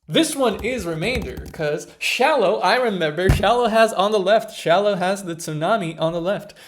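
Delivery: loud, eager rush of recall; excited explaining. Real, not acted. Learner loudly explaining the memory trick for the kanji.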